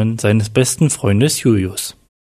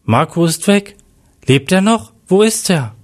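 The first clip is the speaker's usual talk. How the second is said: understated narrated shout, pitched up; mild alarmed cry; 6 semitones higher than usual. A narrator's reading. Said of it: "schrie der angerannte Julius Mann".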